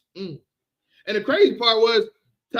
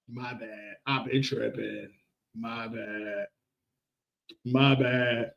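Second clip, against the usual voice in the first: mock apology; 10 semitones lower, 7 dB quieter, slower than usual. Mock apology, low muttered.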